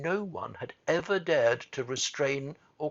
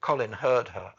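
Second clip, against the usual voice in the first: ordinary delivery, nothing marked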